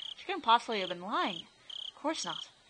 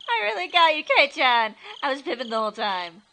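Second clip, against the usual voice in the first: loud, high teasing gloat; teasing girl voice; 4 semitones higher, 10 dB louder than usual. Acted. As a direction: laugh it off, gotcha. Shion laughing it off.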